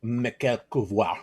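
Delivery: slow, lightly put-on French accent; mock French. Announcing the French whiskey maker in mock French accent. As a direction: Monsieur Michel Couvreur in a hammed-up French accent. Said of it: "I'm just making stuff up".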